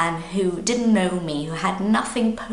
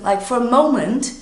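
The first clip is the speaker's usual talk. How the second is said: ordinary talk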